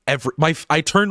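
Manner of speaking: usual speaking voice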